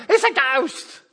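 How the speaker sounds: high, loud frightened shout; scared cry. Retold or imitated. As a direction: yell it like you've seen a ghost. Terrified disciples cry out.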